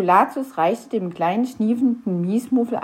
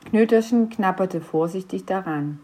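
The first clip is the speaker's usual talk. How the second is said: usual speaking voice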